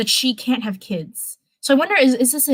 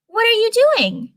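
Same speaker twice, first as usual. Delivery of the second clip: put-on raised, boyish question; high young Peter voice; 13 semitones higher than usual. Teen hero asks Stark what he's doing, high voice. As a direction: pitch way up like an eager teen.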